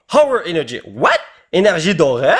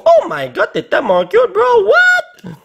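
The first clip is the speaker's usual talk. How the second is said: delighted squeal. Real, not acted. Gushing over a cute card.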